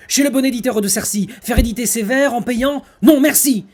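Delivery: loud, high, grand theatrical declamation; hammy stage actor. Acted. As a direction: boom it out like a hammy tragedian. Declaims the non-merci tirade.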